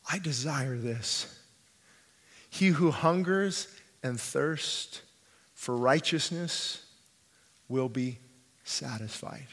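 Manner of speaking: soft, low, pastoral appeal; hushed altar appeal. Acted. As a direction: speak gently into the silence, with long pauses. Quiet closing appeal to congregation.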